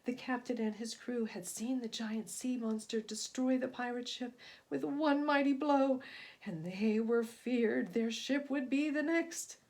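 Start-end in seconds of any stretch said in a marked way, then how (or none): none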